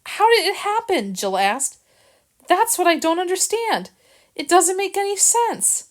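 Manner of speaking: high, distressed woman's voice; lightly voiced Jill, bewildered and hurt; upset young woman. Jill asking Harrison how it happened, distressed.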